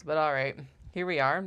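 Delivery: ordinary delivery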